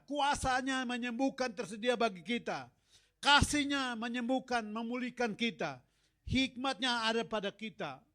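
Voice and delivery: loud, high proclaiming of God's gifts; fervent preaching. Acted. Preacher proclaiming to the congregation, loud and fervent. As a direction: preach it out with rising fire.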